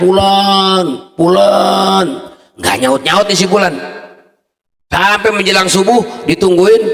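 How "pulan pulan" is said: high, loud calling of a name. Acted. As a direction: shout the dead man's name into the well. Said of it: "dia teriak". (memanggil keras)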